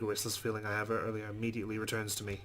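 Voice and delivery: ordinary talk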